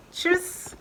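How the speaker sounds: Tschüss in German sounds, said lightly and high; a bright German Tschüss to sign off; German goodbye. Shown as a demonstration. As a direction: chirp Tschüss the way a German would. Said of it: "What the German say?".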